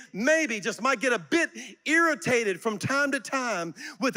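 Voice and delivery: high, loud, fervent preaching; full-force preaching. Acted. Preacher rousing the congregation to a praise break, fervent and loud. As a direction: preach it out to the whole auditorium.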